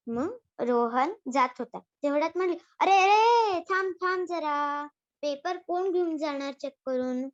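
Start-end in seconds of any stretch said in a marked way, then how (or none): 2.8-7.2 calling out. Acted. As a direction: call him back across the room. Teacher calls Rohan back.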